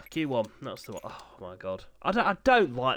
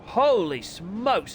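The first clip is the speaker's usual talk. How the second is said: amazed; 7 semitones higher, 4 dB louder, much slower than usual. Real, not acted. Excited commentary on a fast lap.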